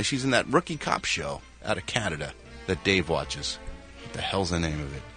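normal talking voice

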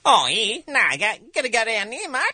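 high, loud, fast broad Australian; Australian accent. Shown as a demonstration. Comedian demos an Australian entering a room, loud Australian accent. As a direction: rattle it off like an Aussie. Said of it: "here's an Australian".

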